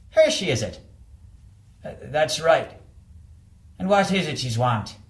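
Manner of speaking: put-on a suspicious old doorman through the intercom; Irish doorman. Doorman questioning the visitor through the intercom, wary Irish voice.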